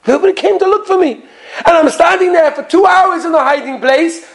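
put-on high-pitched childish complaint; crying child. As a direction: protest like an upset little kid. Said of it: "he's crying with tears down his eyes".

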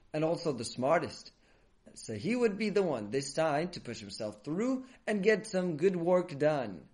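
usual speaking voice

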